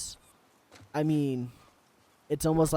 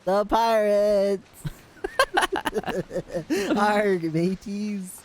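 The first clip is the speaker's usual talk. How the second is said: slow, loud, higher hammy pirate growl; 7 semitones higher, 4 dB louder, much slower than usual. Pretend. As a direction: ham it up like a cartoon pirate. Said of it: "Did I do the pirate voice right?".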